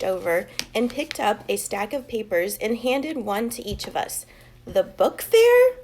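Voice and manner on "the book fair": high, loud, drawn-out delight; excited chorus. A narrator's reading. Class answering teacher together, high excited cry. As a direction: kids thrilled together. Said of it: "we all said together".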